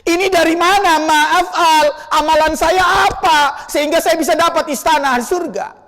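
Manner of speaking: loud, high, astonished question to God; the father in heaven, loudly amazed; father's amazed cry. Acted. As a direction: call out in wonder at the palace. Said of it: "ayahnya, lantas berngomong kepada Allah".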